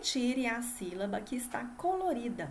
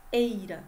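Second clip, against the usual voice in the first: EIRA isolated and punched louder; stressed rhyme ending; 6 dB louder than usual. Shown as a demonstration. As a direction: sound out "eira" firmly for the class. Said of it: "terminam em". ("eira" destacado, com mais força)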